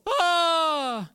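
high sudden mock gasp of fright; a playful pretend scream; mock startled yelp. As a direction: yelp as if something jumped out. Gasps at dangerous world.

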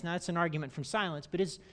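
ordinary delivery

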